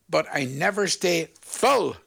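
slow line landing on a drawn-out "full"; stress on full. Shown as a demonstration. Host giving listeners a clue, stress on "full". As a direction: hit the word "full" and hold it. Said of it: "Now, emphasize the word full".

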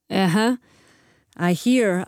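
normal talking voice

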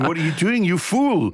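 high, teasing "you fool" in an odd put-on voice; mock scolding. Host teasing the comedian for starting late, mock scolding. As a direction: tut at him like a fond scold.

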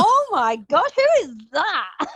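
high, slow mock amazement; amazed parents. Parents amazed at hearing her.

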